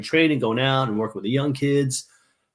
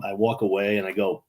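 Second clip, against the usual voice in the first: normal talking voice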